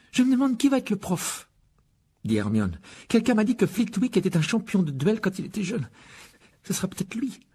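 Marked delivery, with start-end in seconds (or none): 0.1-1.5 high-pitched, wondering Hermione voice; eager schoolgirl. A narrator's reading. Hermione wonders to her friends who will teach, high and curious. 3.1-7.4 put-on high-pitched girl's voice, sharing a rumour; eager schoolgirl. A narrator's reading. Hermione tells her friends a rumour, high and eager.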